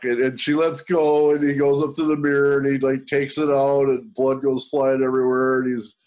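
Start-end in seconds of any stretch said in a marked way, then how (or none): none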